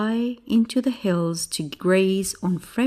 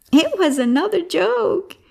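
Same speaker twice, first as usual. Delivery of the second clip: high, gleeful call; happy shout; 12 semitones higher, 4 dB louder than usual. Acted. Shepherd boy revealing his trick to villagers, happy shout. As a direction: crow it out merrily. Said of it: "he shouted happily".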